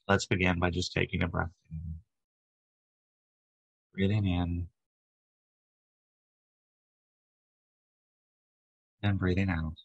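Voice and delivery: even, unhurried meditation voice with long pauses; guided meditation. Acted. Leader guides a group to relax body and breath, guided meditation. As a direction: guide them gently through each breath, leaving space between phrases.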